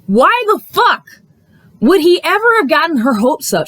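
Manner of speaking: real indignant disbelief, pitched high; incredulous. Real, not acted. Viewer appalled he got her hopes up, incredulous. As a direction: demand an answer, drawing out the first words. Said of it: "sitting here with my jaw on the ground".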